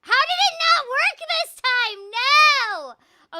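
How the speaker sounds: loud, high frustrated outburst; frustrated shout. Real, not acted. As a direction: cry out as the trick fails. Puzzle trick fails on stream.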